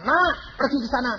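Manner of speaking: high, quick fatherly command; bossing the grown son about; father giving an order. Retold or imitated.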